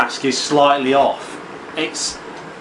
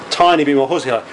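ordinary delivery

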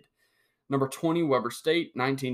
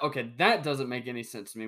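usual speaking voice

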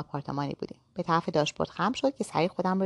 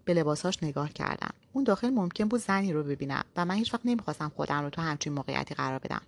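plain reading voice

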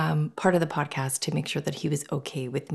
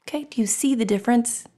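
normal talking voice

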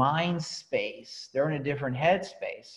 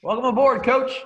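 hearty welcome; 7 semitones higher, 8 dB louder than usual. Retold or imitated. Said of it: "He goes".

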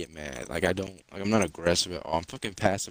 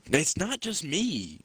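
high, drawn-out retold complaint; whiny protest; 8 semitones higher, slower than usual. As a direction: stretch it out as a pleading objection.

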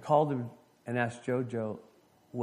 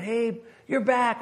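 high, drawn-out, louder hail; excited greeting; 11 semitones higher, 5 dB louder, slower than usual. Retold or imitated. Christy greets the returning pastor, excited. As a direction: greet a friend just back, high and slow.

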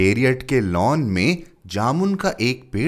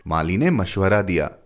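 ordinary delivery

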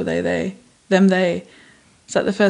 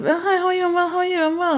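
much higher, twittery mock politeness; mocking sing-song; 10 semitones higher, faster than usual. Making fun of UK greetings, high sing-song mockery. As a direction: parody the ritual exchange. Mocking British small talk ritual.

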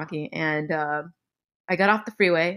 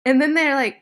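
ordinary talk

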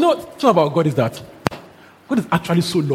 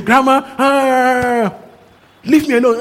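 higher drawn-out mock annoyed grandma; whiny annoyed brush-off; 6 semitones higher, slower than usual. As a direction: drag out Grandma, lightly voicing an irritated old woman. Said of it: "The man was angry".

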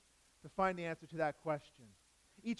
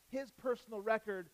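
usual speaking voice

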